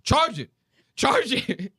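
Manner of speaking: high-pitched imitated command; hammed-up repeated order; mock instructor order. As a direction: bark it like an overexcited trainer.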